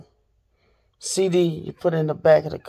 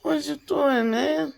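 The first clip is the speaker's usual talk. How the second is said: exasperated; 9 semitones higher, 4 dB quieter than usual. Real, not acted. Exasperated at cousin.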